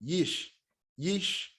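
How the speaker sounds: soft Yish, cut off at the sh; closed syllable Yish. Shown as a demonstration. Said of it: "is gonna be Yish".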